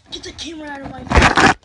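fast, furious shout; angry yell. Real, not acted. Brother yells at the filmer to get the camera away, furious. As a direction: bark him off the camera.

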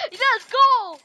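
high, loud victory shout; triumphant cheer. Real, not acted. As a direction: whoop it out high. Celebrating getting the coins.

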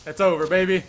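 loud, confident call; cocky holler. Real, not acted. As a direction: crow it loudly. Taunts opponent before a shot.

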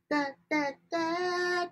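ordinary delivery

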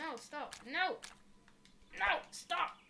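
rising panicked no, stop; panicked shouting. Real, not acted. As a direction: shout it in alarm. Panicking as the mech moves.

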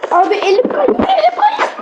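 panicked laughing, pitch climbing; laughing panic as the hand slips; being chased. Real, not acted.

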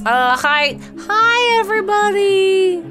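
squeaky, drawn-out hello to the room; awkward high greeting. Acted. Shy newcomer saying hi to everybody, awkwardly high. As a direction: climb into a high, held "hi everybody".

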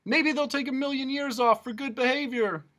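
very high, loud, chirpy; high humbug voice. Acted. Humbug calls to departing Milo in a high loud voice. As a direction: keep calling out cheerfully. Humbug shouting after them.

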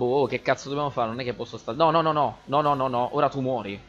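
normal talking voice

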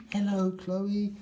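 high, bright-voiced toy says hello; a toy truck calling hello to a friend; cheery toy greeting. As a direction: greet your friend like a happy little toy.

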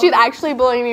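loud laughing mock complaint. Real, not acted. Teased by friends.

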